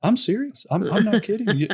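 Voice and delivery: high-pitched, exasperated imitation of a teacher; mock frustration, pitched well up; frustrated old teacher. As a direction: imitate an old man fed up with his class. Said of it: "was so frustrated. He's, he goes".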